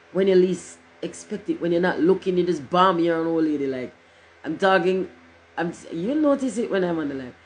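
ordinary talk

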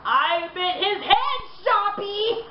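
mock the warden with a grin; gleeful Joker. A narrator's reading. Grinning Joker taunting the warden.

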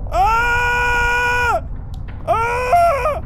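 long, high, loud screams; real piercing shrieks; terrified scream. Real, not acted. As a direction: scream flat out in fright. Jump scare.

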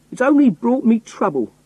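high, loud exasperated cry. A narrator's reading. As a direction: complain bitterly, pitched high. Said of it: "I cried".

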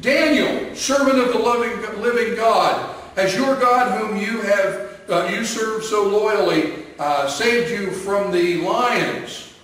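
high, loud anxious call. Acted. King calling into the lions' den, anxious and loud. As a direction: shout down into the den, worried. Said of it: "he called out anxiously".

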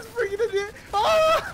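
high, loud, half-crying scream; panicked yell. Real, not acted. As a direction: shriek in panic. Grenades everywhere, panicking.

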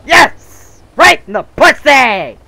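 screaming with excitement; triumphant shout. Real, not acted. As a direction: yell it in victory. Kill celebration in game.

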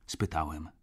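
plain reading voice

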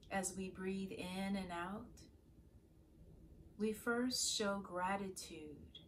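guided meditation. Acted. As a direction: guide listeners gently into prayerful stillness, leaving space to breathe. Leads a morning meditation.

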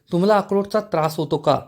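plain reading voice

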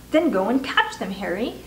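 usual speaking voice

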